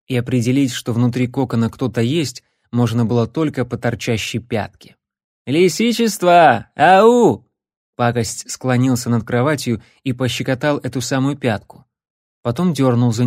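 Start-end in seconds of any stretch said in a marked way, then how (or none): 5.5-7.5 drawn-out loud call. Acted. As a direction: call out to wake him. Calling to wake him up.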